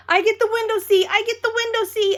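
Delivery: high, fast, loud excited call; loud, excited child calling dibs on the seat. A narrator's reading. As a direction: shout it out like an eager kid. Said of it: "I called real loud".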